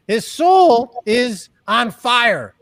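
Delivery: rising sermon that builds to a shout; mock preaching. Mock preacher calling to bring Brother Elon back, building to a shout. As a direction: preach it like a revival pastor.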